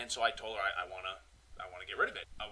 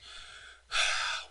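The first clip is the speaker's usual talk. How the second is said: long unvoiced exasperated sigh; demonstrating his big sigh to the agent. Shown as a demonstration. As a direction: let out a big fed-up sigh. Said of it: "I gave a big sigh, one of these".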